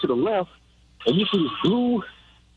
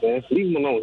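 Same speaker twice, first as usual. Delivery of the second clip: ordinary talk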